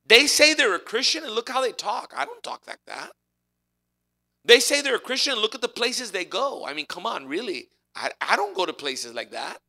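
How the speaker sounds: a touch of a disgusted outsider pointing out hypocrisy; indignant seeker. Retold or imitated. Preacher voices a seeker judging Christians, mildly indignant. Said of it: "they're gonna look at their lives and say".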